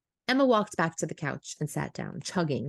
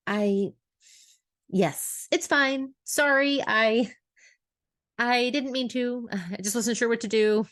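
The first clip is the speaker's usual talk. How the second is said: halting, higher-pitched excuse; flustered apology; 6 semitones higher than usual. A narrator's reading. Emma excusing her texts to Hank, flustered. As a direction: stumble through a nervous apology.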